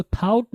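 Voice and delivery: "थाउट" with an ow glide, "thowt"; mispronounced thought. Shown as a demonstration. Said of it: "इसको थाउट नहीं पढ़ेंगे". ("थाउट" غلط تلفظ، "تھاؤٹ")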